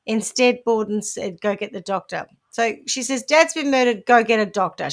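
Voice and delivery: normal talking voice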